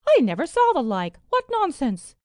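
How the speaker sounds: high, loud mock astonishment; feigned amazement. A narrator's reading. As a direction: exclaim as if you cannot believe it. Duchess feigns amazement at the gold.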